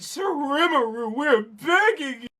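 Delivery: pleading, high and slow; a merchant begs the demon lord, high, slow and pleading; begging merchant. Acted. Streamer voices a merchant begging Rimuru, high and slow.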